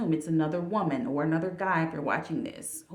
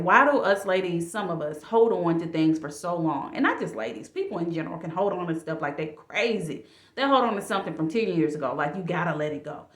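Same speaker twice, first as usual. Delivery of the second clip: normal talking voice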